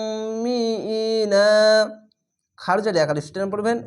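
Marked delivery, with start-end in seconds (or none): 0.1-1.5 উম্মিইনা with the ম্ম held as a long nasal hum, slow; nasal ghunna. Shown as a demonstration. Teacher showing ghunna to students, slow. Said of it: "এখানে ওয়াজিব গুন্না".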